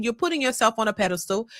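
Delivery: ordinary delivery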